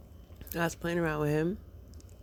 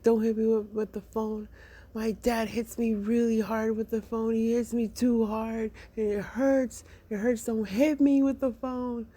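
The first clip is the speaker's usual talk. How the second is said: imitating upset child; 6 semitones higher than usual. Upset son pleads, tears coming.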